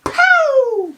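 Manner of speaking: loud high mock bang; firework bang. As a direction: shout the pop of a firework. Firework going off.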